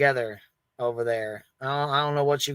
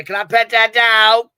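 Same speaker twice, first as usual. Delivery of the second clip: higher, louder, slow put-on voice; mock innocent ask; 6 semitones higher, 9 dB louder, slower than usual. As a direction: play dumb and ask it loudly. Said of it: "walk up to Justin and say".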